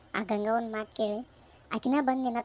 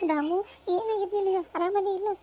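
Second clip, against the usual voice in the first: crying; 8 semitones higher than usual. Acted. Girl asks Ramu what happened to him, crying. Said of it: "ಯಾಕ್ ಅಳಾಕತ್ತಿ".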